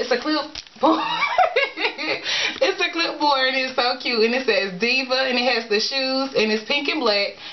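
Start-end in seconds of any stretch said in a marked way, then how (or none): 0.0-3.5 real laugh breaking into the word, drawn out slowly; delighted laughing burst. Real, not acted. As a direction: giggle through it, then blurt out clipboard. Laughing at the diva clipboard.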